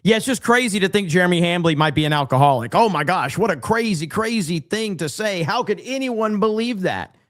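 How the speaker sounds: hammed-up sarcastic incredulity; sarcastic disbelief. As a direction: feign shock at an obviously believable claim. Sarcastic mock disbelief at accusation.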